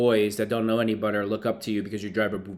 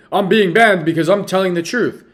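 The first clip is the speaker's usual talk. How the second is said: higher, louder mocking Tate quote; mocking impression; 6 semitones higher, 7 dB louder than usual. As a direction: parody a boastful guy, loud and high. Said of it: "this is Andrew Tate in a nutshell".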